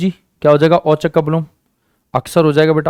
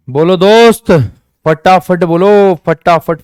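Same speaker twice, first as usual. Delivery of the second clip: calling out; 3 semitones higher, 6 dB louder, slower than usual. Real, not acted. Teacher urging class to answer.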